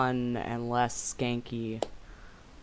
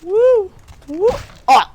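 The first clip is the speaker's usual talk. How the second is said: high, loud whoop; excited whoop; 12 dB louder, slower than usual. Real, not acted. Streamer lands a big hit, high excited whoop. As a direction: cheer like you just nailed it.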